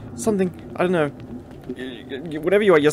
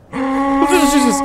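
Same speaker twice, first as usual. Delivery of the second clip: sudden high yelp of fright; jump-scare yelp; startled shout; 12 semitones higher, 10 dB louder than usual. Real, not acted. Startled by monster in game.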